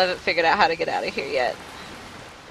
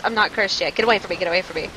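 ordinary talk, nothing marked

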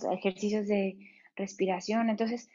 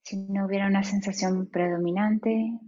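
guided meditation. Acted. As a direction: ease them into stillness, unhurried.

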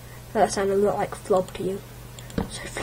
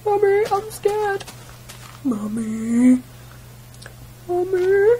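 much higher, whimpered calls; scared child; 9 semitones higher, slower than usual. Retold or imitated. A scared child calling mummy. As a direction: call for mummy like a frightened kid.

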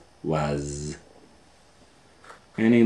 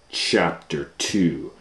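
usual speaking voice

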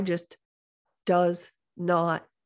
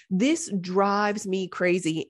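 ordinary talk, nothing marked

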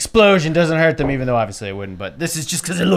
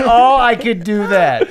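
mocking imitation; 5 semitones higher, 3 dB louder, slower than usual. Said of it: "You just said".

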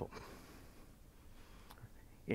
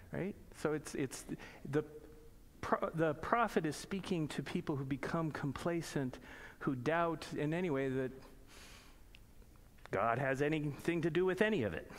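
ordinary delivery

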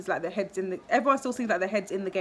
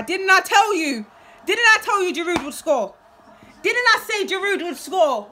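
loud, high gloating at the goal; real elated yelling; excited shout; 6 semitones higher, 5 dB louder than usual. Real, not acted. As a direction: crow that you called it. Celebrating a goal he predicted.

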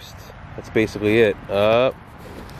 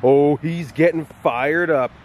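playful hype; 6 semitones higher, slower than usual. Real, not acted. Teasing excitement about the dog.

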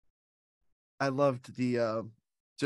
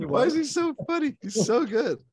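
very high, loud, through laughter; cracking up; 12 semitones higher, 5 dB louder than usual. Real, not acted. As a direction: squeal it out while tickled by the memory. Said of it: "he makes me laugh".

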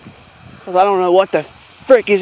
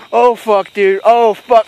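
normal talking voice

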